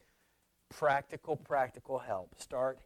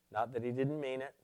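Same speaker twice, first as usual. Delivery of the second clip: ordinary talk, nothing marked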